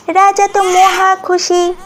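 usual speaking voice

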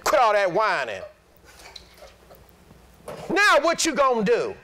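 a hint of an old-school elder cutting off whining; lightly voiced stern pastor's wife; retold stern rebuke. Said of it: "she stepped back off me and she said".

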